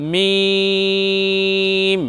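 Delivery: মিম held long with the dry outer lips; slow, drawn-out মিম; lips on dry part. Shown as a demonstration. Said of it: "সামান্য নিয়ে আসব".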